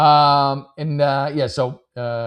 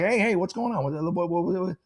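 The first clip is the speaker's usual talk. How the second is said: higher-pitched placating phone voice; acted conciliatory call; 6 semitones higher, 3 dB quieter than usual. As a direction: imitate a quick, high check-in call to a partner. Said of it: "and you're like".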